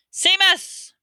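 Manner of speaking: very high, loud roar. A narrator's reading. Teacher summoning the next pupil, roaring. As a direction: bellow the name across the room. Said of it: "roared Professor Lupin".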